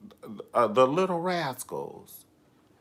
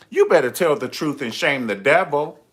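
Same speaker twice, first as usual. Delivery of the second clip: preachy louder saying; 4 semitones higher, 10 dB louder than usual. Retold or imitated.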